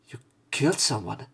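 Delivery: ordinary talk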